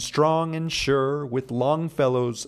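slow, lifted reading of verse; poem recital. Shown as a demonstration. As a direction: declaim the lines like a recital. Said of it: "I'm quoting The Builders by Longfellow".

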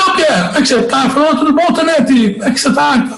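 high-pitched retold hello to an acquaintance; re-enacting an eager greeting; acted-out greeting.

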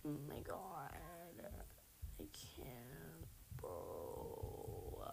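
very low, soft, drained groan; defeated murmur. Real, not acted. Gamer just killed by a head, defeated murmur. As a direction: sink right down, barely voiced.

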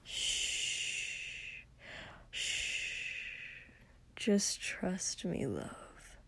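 soft, slow hushing whisper; breathy reassuring whisper; soothing whisper. Acted. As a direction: hush him close and gently. Soothing partner in intimate scene.

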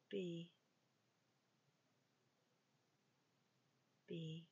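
slow, soft meditation voice, drifting into whispers; guided meditation. Acted. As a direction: guide them into stillness with long pauses. Leads a walking meditation.

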